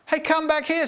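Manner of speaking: high, urgent call; calling after. A narrator's reading. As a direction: call after someone running away. Transpector calls after runaway.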